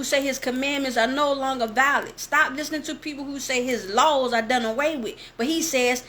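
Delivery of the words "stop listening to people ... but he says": usual speaking voice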